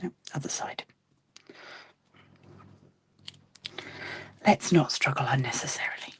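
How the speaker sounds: whispered self-talk; muttering to herself. Real, not acted. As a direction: mutter it under your breath. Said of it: "I'm just muttering to myself now".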